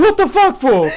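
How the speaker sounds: very high, loud incredulous outburst; retold shock. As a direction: act out stunned disbelief, laughing through it. Acted shock at friend waking up.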